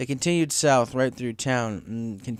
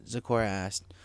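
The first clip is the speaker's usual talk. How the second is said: plain reading voice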